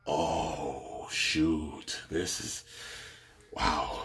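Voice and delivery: low, soft dismay; retold hushed disbelief; stunned awe. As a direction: breathe it out like a stunned onlooker. Retold stunned reaction to the fight.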